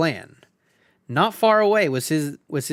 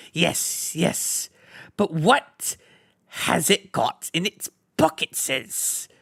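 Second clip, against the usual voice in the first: wheedling, hissing creature; sly Gollum bargaining, lisping and sneaky; 5 semitones higher than usual. A narrator's reading. Gollum voiced in story.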